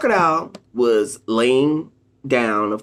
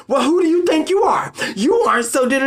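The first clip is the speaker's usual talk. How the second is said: much higher, snide, indignant voice; mock nasty narcissist; 11 semitones higher than usual. Narcissist turning on the accuser, high snide mock retort. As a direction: snap back like the mask just dropped. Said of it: "that smile ... will change into".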